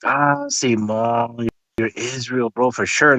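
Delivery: a touch of a laid-back street greeting; mock homie greeting. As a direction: lightly imitate a friend sizing someone up, slowly. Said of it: "when I see my Mexican people".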